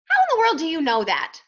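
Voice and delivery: high, loud disbelief; astonished. A narrator's reading. Dodsworth baffled by the duck's knowledge, astonished. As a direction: burst out in surprise.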